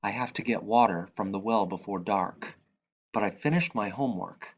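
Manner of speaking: ordinary delivery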